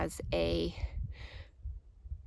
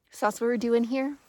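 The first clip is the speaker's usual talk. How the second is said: rising, louder, quicker declaration; rousing; 5 semitones higher, 6 dB louder, faster than usual. Real, not acted. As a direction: lift it with conviction.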